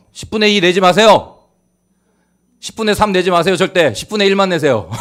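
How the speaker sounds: loud, high preacher's exhortation, close to laughing; emphatic preaching. Real, not acted. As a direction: belt it out to the congregation with humour. Preaches at full force, jokingly.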